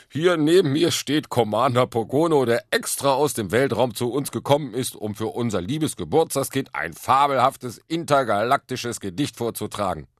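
loud, grand showman's announcement; festliche Ansage. A narrator's reading. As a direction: present the star act to the room. Uncle announces guest to the party. (laut und großspurig angekündigt)

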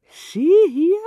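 high, astonished cry of recognition; startled, can't believe who she sees; sharply high and loud in surprise; surprised. A narrator's reading. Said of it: "fragte Frau Becher verwundert".

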